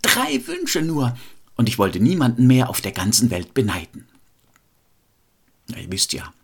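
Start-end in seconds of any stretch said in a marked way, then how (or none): 0.0-4.1 high-pitched, longing cry of a wish; storyteller's woodcutter wishing aloud; calling out loud. A narrator's reading. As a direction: call out a heartfelt wish, pitched up. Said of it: "und rief dabei ganz laut".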